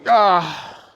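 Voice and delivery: loud released groan; effort groan. Real, not acted. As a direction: let out a loud gasp of effort. Finishing a hard set.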